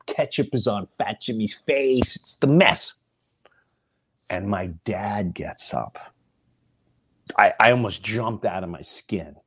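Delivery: normal talking voice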